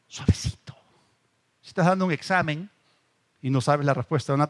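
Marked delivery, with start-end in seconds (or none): 0.1-0.8 breathy whisper of the word suavecito. Shown as a demonstration. Preacher showing how God speaks, whispering suavecito. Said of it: "es algo".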